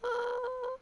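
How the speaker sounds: high cracked filler sound; voice cracking. Real, not acted. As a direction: let the voice break upward. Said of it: "don't mind my voice cracks".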